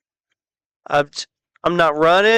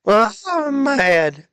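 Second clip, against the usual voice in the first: higher and slow, stretched-out whiny "mad"; mock whine; 7 semitones higher, slower than usual. Gamer repeating his complaint in a higher, slower whine. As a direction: draw it out high like a mock complaint.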